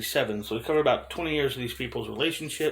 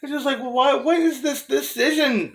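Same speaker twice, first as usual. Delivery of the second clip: high laughing questions; laughing disbelief; 12 semitones higher, 5 dB louder than usual. Real, not acted. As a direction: ask through laughter in disbelief. Baffled by Leto's performance.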